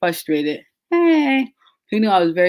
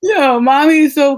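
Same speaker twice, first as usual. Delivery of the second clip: excited burst; 7 semitones higher, 7 dB louder than usual. Real, not acted. Laughing, telling about her mom.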